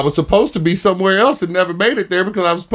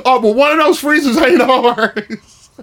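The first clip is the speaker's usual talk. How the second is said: higher, slowed mock yell; retold yell; 7 semitones higher than usual. Sister yelling a warning about the freezers, mock yell. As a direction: call it out like a warning from across the room. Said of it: "this is what she yelled at me".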